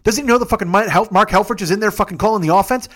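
usual speaking voice

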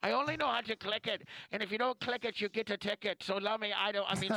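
high, hammed-up Irish lilt for the wife; mock Irish wife. Friend mimics the wife in a mock Irish accent, high and silly. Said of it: "now she's Irish".

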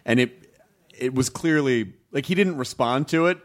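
ordinary talk

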